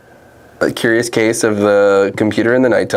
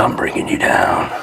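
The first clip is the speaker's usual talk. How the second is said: low breathy whisper echo. Pretend. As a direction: whisper it back like a secret. Echoing partner in whisper.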